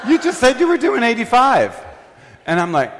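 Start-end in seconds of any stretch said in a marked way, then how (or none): 0.1-1.8 much higher, loud mock tattle; hammed-up friend blurting it out; tattling friend. Friend exposes driver to the cop, high tattling.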